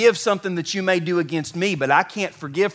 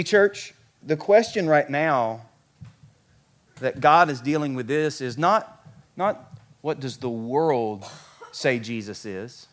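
ordinary talk

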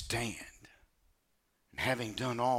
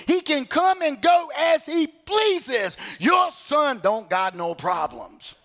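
retold heated rebuke; 12 semitones higher, 7 dB louder than usual. Pastor scolding the father over his son, shouting. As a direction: lay into him harder each line.

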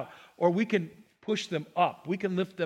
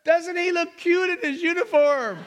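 doting mother; 10 semitones higher than usual. Retold or imitated. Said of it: "she turned around to someone".

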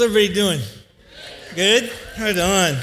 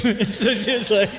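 ordinary talk

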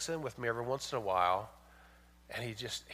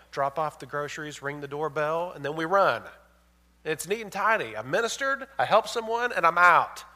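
full-force preaching; 3 semitones higher, 9 dB louder than usual. Acted. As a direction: preach it out to the whole church. Mocks quick drop-off charity.

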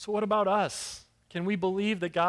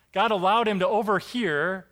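usual speaking voice

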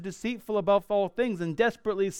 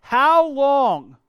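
loud, raised, pleading lament; crying out to God; 5 semitones higher, 6 dB louder than usual. Acted. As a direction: cry out in complaint, pitched high on the last question. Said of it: "he's crying out to God".

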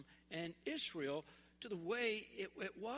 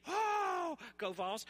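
sudden high, loud whoop for the team; Oh squealed very high, then go Vols spoken; fan cheer; 6 semitones higher, 5 dB louder, much slower than usual. Real, not acted. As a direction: cheer for your home team. Great state of Tennessee.

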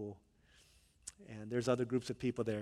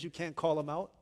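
ordinary delivery

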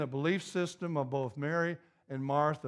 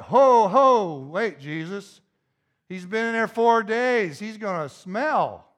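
loud, high calling halt, then protesting; alarmed objection; 4 semitones higher, 8 dB louder than usual. Acted. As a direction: call out to stop him, acting out Martha. Acted Martha stopping Jesus at tomb.

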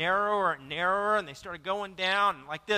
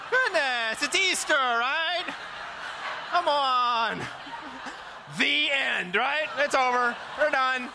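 laughing; 6 semitones higher, slower than usual. Real, not acted. Pastor laughing at own joke.

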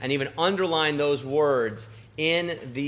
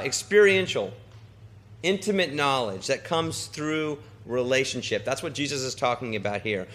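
normal talking voice

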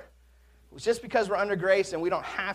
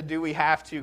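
ordinary talk, nothing marked

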